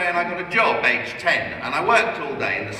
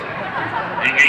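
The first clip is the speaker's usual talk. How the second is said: usual speaking voice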